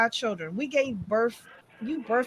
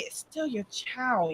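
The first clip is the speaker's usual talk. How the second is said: normal talking voice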